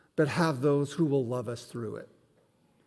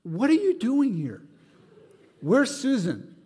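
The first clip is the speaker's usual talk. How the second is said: startled questions; 7 semitones higher, 4 dB louder than usual. Retold or imitated. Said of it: "then I'm going through".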